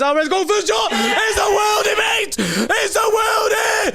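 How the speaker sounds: screaming with joy at a goal; long goal yell. Real, not acted. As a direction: roar it out, high and stretched. Live goal celebration shouting.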